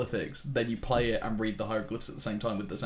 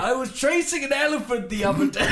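put-on high, loud old adventurer; elephant hunter voice; 7 dB louder than usual. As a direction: boom like a colonial hunter.

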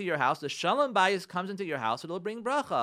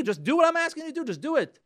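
raised, louder mock impatience; impatient demand; 10 semitones higher, 5 dB louder, faster than usual. Spouse assuming the other thinks alike, acted impatient insistence. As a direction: act the spouse who just wants obedience.

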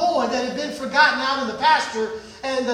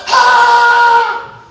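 loud, high held battle yell; war cry; 7 semitones higher, 10 dB louder than usual. Acted. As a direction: roar as a whole army.

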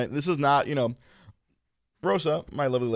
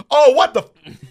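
high, loud cut-off outburst; mock outburst; 14 semitones higher, 12 dB louder than usual. Reacting to the opera, a loud mock outburst. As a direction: start to swear and bite it off.

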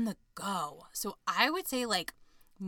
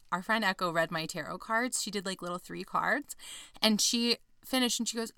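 normal talking voice

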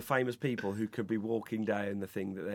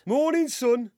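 pompous mayor dad; 10 semitones higher, 6 dB louder, slower than usual. Acted.

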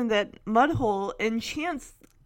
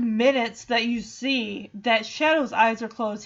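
normal talking voice

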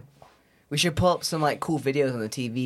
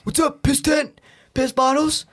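mocking bully voice; 12 semitones higher, 5 dB louder than usual. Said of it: "they bully me".

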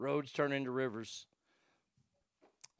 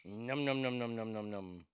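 soft playful num-num chant, fading lower; 5 semitones lower, 6 dB quieter than usual. Real, not acted. Feeding Roscoe a cracker, soft baby-talk munching. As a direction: mimic munching for the pet.

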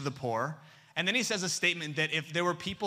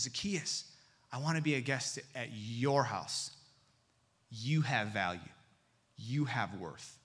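tender; 5 semitones lower, 4 dB quieter than usual. Acted. Jesus speaking with love.

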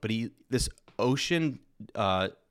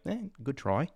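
put-on Australian accent; 4 semitones higher, 3 dB quieter than usual. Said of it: "you sound more like New Zealandy".

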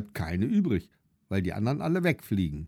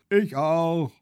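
put-on a chorus of kids, pitched higher; higher child voices in unison; children calling out; 6 semitones higher, 4 dB louder than usual. A narrator's reading. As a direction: call it out like a whole class chiming in. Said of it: "mindestens zehn andere Kinder rufen ebenfalls".

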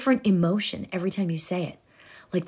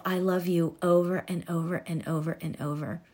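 soft repeated affirmation; 3 semitones lower, 7 dB quieter than usual. Shown as a demonstration.

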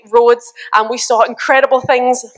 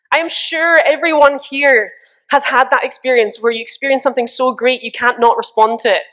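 usual speaking voice

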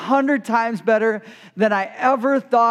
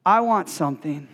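architect's reply; 4 semitones lower than usual. Retold or imitated. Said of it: "he said".

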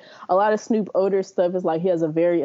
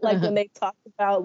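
usual speaking voice

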